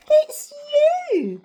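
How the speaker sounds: very high, loud reveal; gleeful storybook teasing; amused exclamation. A narrator's reading. Narrator voices duckbills answering puzzled Drip, amused exclamation. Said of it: "And they laughed and said".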